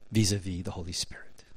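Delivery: soft breathy whisper, dropped low; whispered aside. Real, not acted. Preacher whispering a clarification to congregation, hushed. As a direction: slip it in under your breath.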